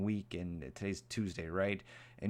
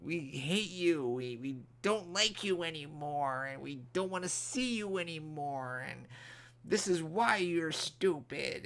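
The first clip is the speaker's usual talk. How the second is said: mock hateful commenter; 6 semitones higher than usual. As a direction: parody an angry commenter's rant. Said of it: "people yelling and screaming and hatred".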